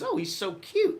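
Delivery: high mock swoon of a smitten woman officer. Female officer letting a speeder off, high mock swoon. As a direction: squeal it like a smitten cop. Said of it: "this female police officer came up and she goes".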